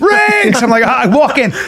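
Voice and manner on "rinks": high drawn-out hollered name; imitated scream. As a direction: holler a name across a store. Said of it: "I'm getting screamed at".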